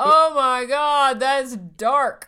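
slow, loud shocked exclamation. Real, not acted. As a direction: gasp out the shock. Shocked by the picture.

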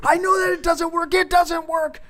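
high, rapid mock yell; hammed-up frantic protest; exasperated outburst. Dad reenacts arguing with his kid, exasperated outburst.

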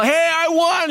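high, gleeful announcement; excited shout-out. Retold or imitated. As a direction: share the win with the whole bus. Telling everyone on the bus.

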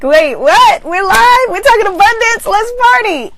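high, loud party-animal cat voice; cat squealing to get the party started; excited cat. Acted. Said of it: "my cat comes out like".

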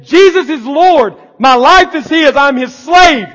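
loud, high, shouting each phrase; shouted confession. Acted. Believer crying out faith to the church, shouting.

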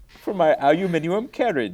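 high, loud, affected pomposity; grandly over-refined, pitched high; mock aristocrat. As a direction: play a hammed-up British toff. Joking as an aristocratic customer.

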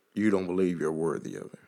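ordinary talk

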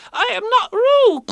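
high squeaky loud protest; loudly. A narrator's reading. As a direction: shout the denial. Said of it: "said Piglet loudly".

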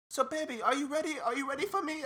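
high, coaxing woman's voice; imitated female caller. Woman calling back to ask if he's ready, imitated caller. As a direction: mimic her, high and sweet.

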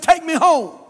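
put-on boy begging his friends to take him home. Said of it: "he said".

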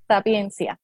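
usual speaking voice

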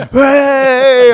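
loud, high shout of a cheering crowd. Retold or imitated. Retold crowd erupting.

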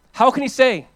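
ordinary delivery

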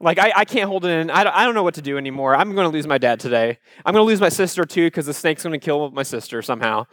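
usual speaking voice

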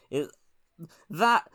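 ordinary talk, nothing marked

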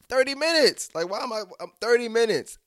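high, loud squawk of disbelief; incredulous outburst. Retold or imitated. Protesting he was only in thirty minutes, high and incredulous. Acting out his disbelief in thought.

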